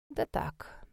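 low, soft brush-off; dismissive. A narrator's reading. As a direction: wave it away quietly, as nothing important. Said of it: "отмахнулся маг". (тихо, низко, отмахиваясь)

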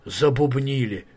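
plain reading voice

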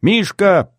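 high, loud call from out of sight; yelling. A narrator's reading. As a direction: yell the name out. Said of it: "заорал невидимый мне Изя".

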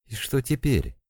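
high, anxious question at normal volume; almost hysterical. A narrator's reading. Vita asks what to do now, nearly hysterical. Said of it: "почти истерически спросила Вита".

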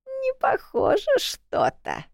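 высокий медленный старушечий голос; недоверчиво тянет слова; grumpy old woman. A narrator's reading. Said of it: "бабка подозрительно прищурилась". (подозрительная бабка, высоко и медленно)

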